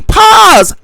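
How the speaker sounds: a sharp, loud, high "Pause."; shouted pause. Real, not acted. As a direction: cut her off with a shout. Cutting off the other woman.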